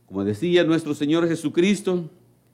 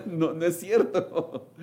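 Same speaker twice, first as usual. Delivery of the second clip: laughing through the words; half-laughing, taking the joke back; 2 semitones lower, 4 dB quieter, slower than usual. Real, not acted. Preacher laughing at his own joke to the congregation, chuckling.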